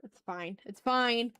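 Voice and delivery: usual speaking voice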